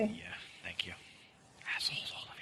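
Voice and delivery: breathy muttered aside; whispering. Real, not acted. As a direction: whisper it under your breath.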